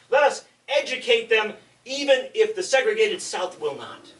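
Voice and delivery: loud, rousing orator's call, slowed down on key words; rallying call. Retold or imitated. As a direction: rouse the porters as if preaching to a crowd. Voicing Randolph's rallying call to porters.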